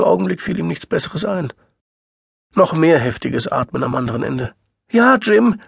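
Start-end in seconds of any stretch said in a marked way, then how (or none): 4.9-5.6 high, loud wailing; blubbering. A narrator's reading. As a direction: wail it through tears. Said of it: "heulte sie".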